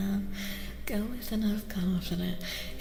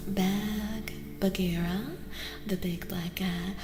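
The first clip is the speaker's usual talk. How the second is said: ordinary talk, nothing marked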